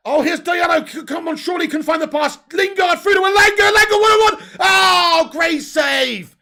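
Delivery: high, loud shouting play-by-play; excited shouting. Real, not acted. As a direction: yell the chance as it unfolds. Live commentary on a big chance.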